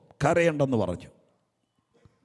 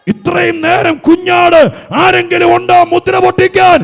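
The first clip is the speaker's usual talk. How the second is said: loud, high, rising preaching shout; preaching at full force; 8 semitones higher, 13 dB louder than usual. Acted. Preacher shouting the promise to the weeping congregation. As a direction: thunder it out to the whole church.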